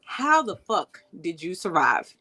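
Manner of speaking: usual speaking voice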